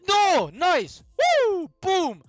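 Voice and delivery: screaming with joy; ecstatic screaming. Real, not acted. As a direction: explode into victory shouts. Big pull lands.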